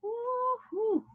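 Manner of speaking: high, light whoop; playful cheer. Pretend. Teacher cheering pupils on to improve, playful high whoop.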